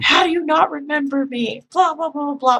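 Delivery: high-pitched mock indignation; mock infuriated caller. Forgotten regular caller ranting at operator, mock infuriated. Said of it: "It infuriates him".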